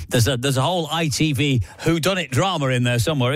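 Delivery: normal talking voice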